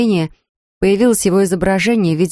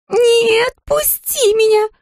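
put-on high pleading dragon girl; much slower than usual. Dera struggling, pleading.

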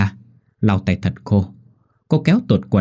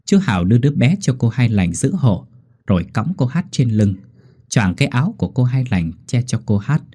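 usual speaking voice

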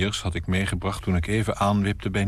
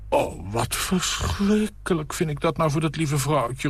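high, theatrical dismay; tragedienne cry; 8 semitones higher than usual. Acted. Nina acting shocked about the poor wife, theatrical. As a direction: overplay the shock like an actress. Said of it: "roept ze met ronde tragedienne ogen".

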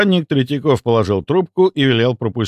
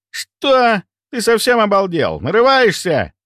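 indignant outburst; 11 semitones higher, slower than usual. Acted. Andrey flares up at insult.

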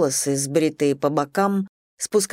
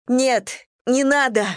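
desperate plea; 7 semitones higher, slower than usual. Acted. Captive begs them to stop.

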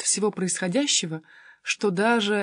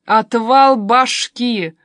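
loud, drawn-out cry of delight; excited shout; 3 semitones higher, 9 dB louder, much slower than usual. A narrator's reading. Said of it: "закричала Полина при виде меня". (восторженный крик)

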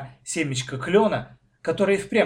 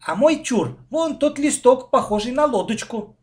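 eager, high-pitched claim; louder, higher, keen child's call; hurrying to claim; 11 semitones higher, 6 dB louder than usual. A narrator's reading. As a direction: stake your claim before anyone else. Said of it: "тут же поспешила с выбором Маша".

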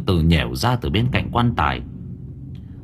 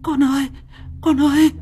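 high, panicked wailing; distraught, gasping high-pitched cries; horror-struck. Acted. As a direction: cry out for your child in horror. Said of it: "Cô Hoa kinh hãi".

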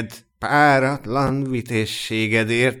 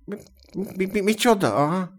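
higher, stuttering disbelief; baffled stammer; 6 semitones higher, 4 dB quieter than usual. A narrator's reading. Elves blink at the knight's answer, baffled stammer. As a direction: stumble on the first syllable, thrown.

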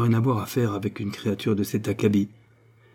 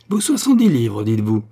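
furious mockery; 4 dB louder than usual. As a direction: hurl it after him as he flees. Sophie rages at fleeing Boodle.

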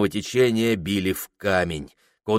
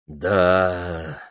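dopey voice; 5 semitones lower than usual. Acted. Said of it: "протянул в тупом раздумье Прохор".

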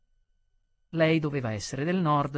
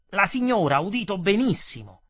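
loud, higher-pitched retort; angry insistence; 4 semitones higher, 6 dB louder than usual. Acted. Customer insisting the lady heard perfectly, angry and loud. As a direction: contradict him forcefully, voice raised. Customer contradicts waiter in quarrel.

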